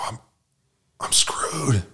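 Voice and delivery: breathy, defeated whisper; whispered dismay. Retold or imitated. Man realizing he qualifies as alcoholic, whispered. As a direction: let it slip out under your breath.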